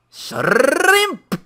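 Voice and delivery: high, loud, drawn-out Shrimp; shouted punchline. Acted. Player voicing a girl yelling her joke, long high shout. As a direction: belt the pun like a big finale. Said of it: "She takes the stage again".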